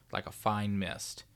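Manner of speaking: ordinary delivery, nothing marked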